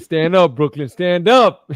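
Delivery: loud rallying call; hometown cheer. Real, not acted. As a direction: shout it out proudly for Brooklyn.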